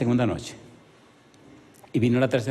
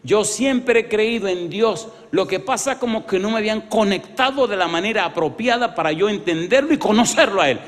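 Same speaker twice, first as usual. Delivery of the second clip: Sonia's testimony; 7 semitones higher, 4 dB louder than usual. Retold or imitated. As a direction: testify as Sonia, climbing to a loud close. Pastor voices Sonia's testimony. (Sonia da su testimonio al pastor, voz aguda que crece en volumen)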